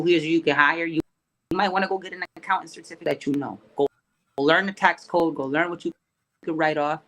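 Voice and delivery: ordinary delivery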